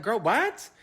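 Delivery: high, loud, baffled question; incredulous reaction. Retold or imitated. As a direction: blurt it out in mock disbelief. Baffled reaction to being sung to.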